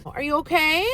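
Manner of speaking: high, concerned pet voice; worried coo to dog. Real, not acted. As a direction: check on the dog tenderly. Asking the dog in the back.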